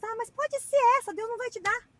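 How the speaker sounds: squeaky mock whine of a doubter; mock doubting voice. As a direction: parody someone talking themselves out of it. Mocking a doubting prayer.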